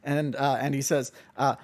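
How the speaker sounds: ordinary talk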